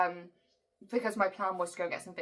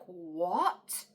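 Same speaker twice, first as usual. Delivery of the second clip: a mild retold gasp of disbelief, "What?" rising; imitating the doctor's stunned "What?", kept light; shocked what. Doctor hearing about the pharmacist, mildly shocked "What?". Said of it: "she was like".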